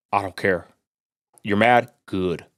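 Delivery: usual speaking voice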